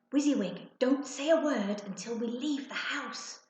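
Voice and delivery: usual speaking voice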